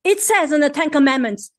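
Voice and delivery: usual speaking voice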